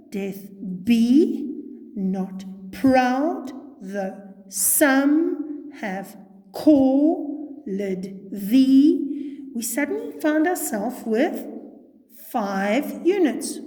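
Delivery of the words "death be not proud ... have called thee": slow scansion, leaning on "be", "proud", "some", "called" and "thee"; iambic beat. Shown as a demonstration. Teacher marking five units of meter, slow heavy stress on "be", "proud", "some", "thee". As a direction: punch every second syllable, "be", "proud", "some", with gaps between. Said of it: "And so when we go".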